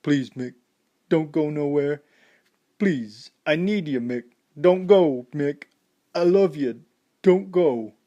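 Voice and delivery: tearful cries of don't go; desperate pleading. A narrator's reading. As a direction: beg him not to die. Rocky begs dying Mickey.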